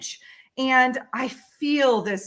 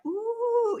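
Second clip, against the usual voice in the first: high, soft, drawn-out ooh; little kid's wonder; 12 semitones higher, 4 dB quieter than usual. Retold or imitated. Storyteller spotting an orange orb, imitating a little kid's wonder. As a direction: coo like a child entranced. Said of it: "it's like a little kid".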